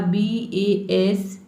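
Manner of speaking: slow letter-by-letter spelling. Shown as a demonstration. Teacher spelling to students, slow and clear. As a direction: spell each letter out slowly. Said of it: "ठीक है, बा".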